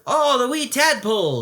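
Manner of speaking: put-on fond old Scot on the phone; Scottish accent, kindly; Scottish uncle. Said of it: "he said".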